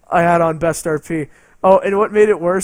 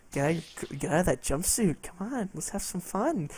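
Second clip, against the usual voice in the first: much softer, coaxing come-on; mock seductive; 12 dB quieter than usual. Imitating the naked player luring him, softly seductive. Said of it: "she's like".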